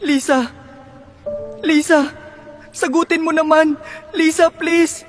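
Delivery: desperate high calls; calling out. Acted.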